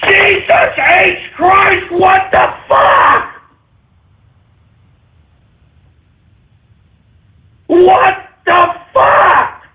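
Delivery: high, slow, drawn-out yell; horrified shout. Real, not acted. Host reacting to a gross picture, slow horrified shout. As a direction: stretch every word out in disbelief.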